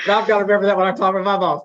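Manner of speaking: laughing. Real, not acted. Laughs at own joke about boss.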